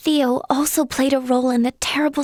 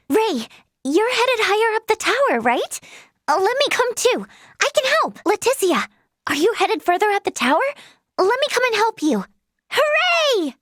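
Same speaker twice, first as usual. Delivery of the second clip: high, bubbly pleading to come along; excited, high-pitched enthusiasm; eager excitement; 6 semitones higher than usual. Acted. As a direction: beg eagerly to tag along, ending in a high cheer. Eager plea to join, then cheer.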